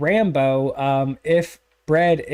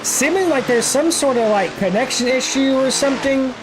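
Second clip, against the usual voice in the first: higher, lifted live race call; play-by-play; 4 semitones higher than usual. Acted. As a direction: call the run as it happens.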